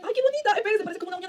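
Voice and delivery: very high, delighted ay; delighted squeal. Real, not acted. Seeing the finished nail, high delighted squeal. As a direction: squeal over the pretty nail.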